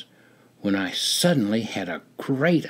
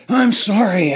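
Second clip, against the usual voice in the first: loud, high sputtering apology; sputtered; 10 semitones higher, 6 dB louder than usual. Acted. Soldier to the guys at the front, sputtering. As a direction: spit it out mid-growl. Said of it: "I sputtered between growls and grunts".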